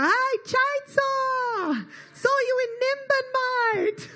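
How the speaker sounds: high, slow, hammed-up ocker yell; Aussie fan call-out. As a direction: call across the room like a rowdy local. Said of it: "just go".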